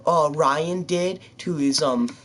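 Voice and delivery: mock shocked schoolgirl chatter; gossiping schoolgirls. Schoolmates spreading the breakup news, mock gossip. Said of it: "they're all gonna be like".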